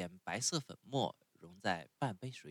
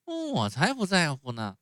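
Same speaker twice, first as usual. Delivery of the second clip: put-on higher, louder stubborn child; defiant puppet boy; 8 semitones higher, 10 dB louder than usual. A narrator's reading. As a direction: snap back defiantly. Pinocchio defiant.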